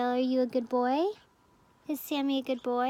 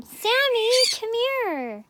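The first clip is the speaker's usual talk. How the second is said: calling out; 10 semitones higher, 8 dB louder, slower than usual. Real, not acted. Calling the bird back.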